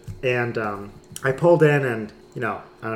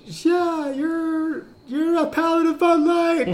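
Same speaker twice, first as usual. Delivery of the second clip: silly high voice; 3 dB louder than usual. Pretend. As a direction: squeak it out like a cartoon neighbor. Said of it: "there's Obert's voice".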